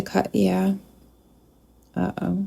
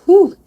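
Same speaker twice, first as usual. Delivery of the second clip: emotional exhale; 11 semitones higher, 7 dB louder than usual. Real, not acted. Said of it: "you're making me cry".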